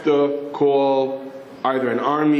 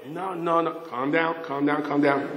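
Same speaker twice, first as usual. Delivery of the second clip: softer, placating murmur; soothing; 6 dB quieter than usual. Retold or imitated. Someone soothes the angry patrolman.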